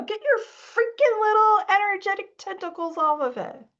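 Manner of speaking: high, exasperated playful scold. Real, not acted. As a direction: tease them to let go.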